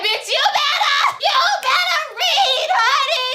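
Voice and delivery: high, loud screaming cheer; shouting hype. Retold or imitated. Recapping Pose to viewers, screaming excited hype. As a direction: scream it like a hyped fan. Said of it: "I said".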